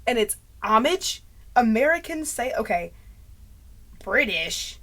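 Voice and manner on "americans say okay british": ordinary delivery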